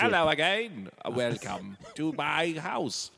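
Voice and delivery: attempted British accent. Partner copying a sample line, attempted British accent. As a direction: slowly try out a British accent. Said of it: "I can't do it".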